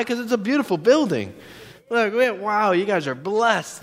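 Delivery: high-pitched gushing wonder; retold delight. Couple arriving at church, high-pitched delight.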